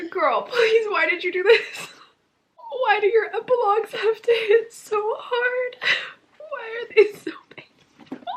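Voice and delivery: high tearful pleading; crying. Real, not acted. Reader begging the author Suzanne Collins, crying. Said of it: "I haven't cried like this".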